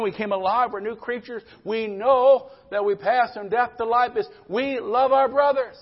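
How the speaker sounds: rising pulpit fervor; fervent preaching. Acted. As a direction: preach it out to the congregation, full force. Preaching at full force.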